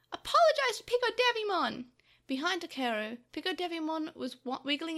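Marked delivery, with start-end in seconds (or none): 0.1-1.9 high, loud angry order; angry yell. A narrator's reading. Takeru orders Tokomon to say sorry, yelling angrily. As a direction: demand it at a shout. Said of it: "Takeru yelled angrily".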